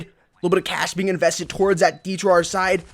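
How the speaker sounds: ordinary delivery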